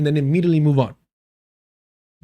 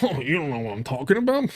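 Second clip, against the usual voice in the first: mocking imitation; 2 semitones lower, 6 dB quieter, faster than usual. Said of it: "it immediately goes".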